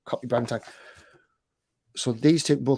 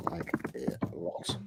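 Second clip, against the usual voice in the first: very soft, low, slow half-voiced murmur; slow self-talk under the breath; murmuring while typing; 8 semitones lower, 11 dB quieter, much slower than usual. Real, not acted. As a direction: mumble the words to yourself as you type. Murmuring words while typing them.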